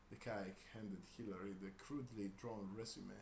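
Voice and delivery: ordinary delivery, nothing marked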